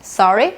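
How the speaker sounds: "Sorry" sweeping up at the end; demonstrated questioning rise on "Sorry"; rising intonation. Shown as a demonstration. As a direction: let "Sorry" climb like a question. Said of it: "the rising intonation".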